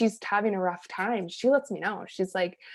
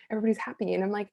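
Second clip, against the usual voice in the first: ordinary talk, nothing marked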